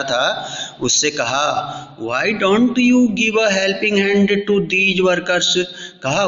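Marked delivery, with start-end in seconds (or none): 2.0-5.6 higher, drawn-out reading of the quote; slow raised reading. Shown as a demonstration. Teacher reading the president's words slowly to the class. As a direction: read the question out slowly, pitched up.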